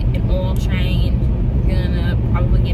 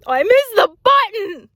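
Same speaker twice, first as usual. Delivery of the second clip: exclaiming; 13 semitones higher than usual. Real, not acted. Vlogger who missed the stop button, exclaiming high. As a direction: cry out high over a fumble.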